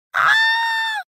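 very high shrill bird cry. A narrator's reading. Duckling cries out to the swans, shrill and piercing. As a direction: screech like a startled swan. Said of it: "un verso tanto stridulo".

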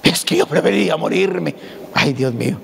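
a changed voice, mimicking a self-pitying moaner; hammed-up melodramatic sufferer; mock complainer. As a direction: parody someone who always says they're dying. Mocking people who say they die.

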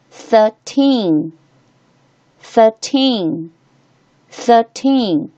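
put-on thirteen with teen drawn out and falling; stress on TEEN. Shown as a demonstration. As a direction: say thirteen slowly, leaning on the final teen. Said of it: "重读音节在这里哦".

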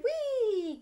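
high, loud squeal of "Wee"; frog's cry. Acted. Frog cries out to its dancing friends, high and loud. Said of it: "and frog cried".

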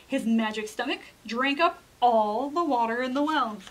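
ordinary delivery, nothing marked

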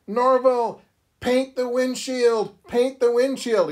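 high, urgent team-mate's yell; mock yelling. Teammates yelling orders at Norval, high mock yell. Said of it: "other members of the team are yelling".

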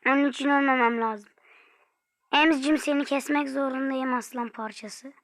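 usual speaking voice

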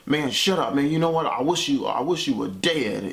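retold angry outburst, kept mild; snapping. Dad snapping at his son in the car, mildly angry. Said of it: "he snapped on me".

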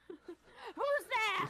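high, startled "who's that". Retold or imitated. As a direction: jump at a noise. Scared at night noises.

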